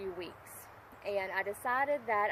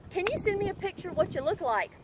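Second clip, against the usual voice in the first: mocking quote; 7 semitones higher, faster than usual. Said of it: "I get a text going".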